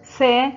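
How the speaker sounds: loud, clear announcement of سا; loud teacher naming the letter سا; letter announced. Shown as a demonstration. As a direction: call out سا to the class. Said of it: "حرف السين محرك بحركة الفتح".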